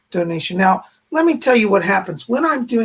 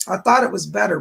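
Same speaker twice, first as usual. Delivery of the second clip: ordinary talk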